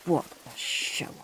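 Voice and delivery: soft unvoiced aside; inner thought whisper. A narrator's reading. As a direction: think it to yourself in a hush. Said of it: "thought Henry".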